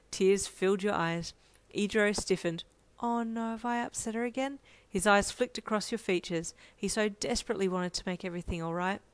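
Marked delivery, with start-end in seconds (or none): 3.0-4.6 higher-pitched, anxious aside; worried thought. A narrator's reading. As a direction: fret to yourself in a small high voice. His worried inner thought.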